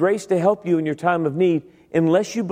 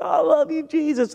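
high, swooning "I love you"; much higher breathless adoration; gushing worshipper; 12 semitones higher than usual. Retold or imitated. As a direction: swoon it out like an over-the-top worshipper. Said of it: "people were like".

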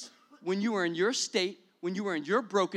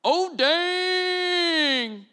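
high mock passerby shrugging it off; passerby's shrug; 9 semitones higher, 7 dB louder than usual. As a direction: toss off a careless oh dang. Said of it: "and he goes".

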